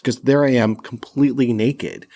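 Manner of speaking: usual speaking voice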